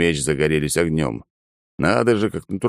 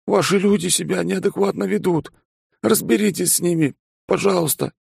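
trembling voice. Acted. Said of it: "Дрожащий голос нашего пилота".

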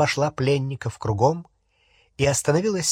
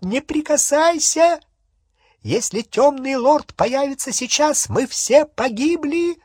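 high, panicked shrieking; frantic high-pitched yell; piercing cry; 13 semitones higher, 4 dB louder than usual. A narrator's reading. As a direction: warn them shrilly of deadly danger. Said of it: "пронзительно крикнула Беллатриса".

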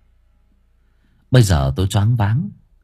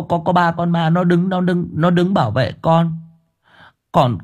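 put-on a frightened child on the verge of tears; whimpering; 7 semitones higher than usual. Boy whimpering to his uncle about the ghosts, near tears. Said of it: "Tôi mếu máo".

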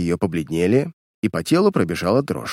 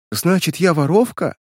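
sobbing; 7 semitones higher than usual. Acted. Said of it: "разрыдалась Лилиан".

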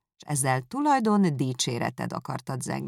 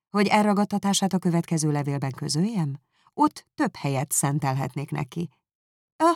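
usual speaking voice

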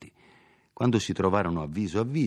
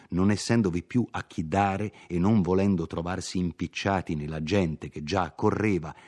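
plain reading voice

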